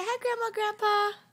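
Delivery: put-on loud, high "Grandma, Grandpa" for the baby; loud coaxing prompt. Shown as a demonstration. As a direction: call out the greeting for the baby to repeat.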